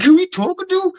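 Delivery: high, incredulous challenge; indignant retort. Retold or imitated. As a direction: fire it back in disbelief. Confronting the bully.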